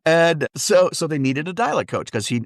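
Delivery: ordinary delivery, nothing marked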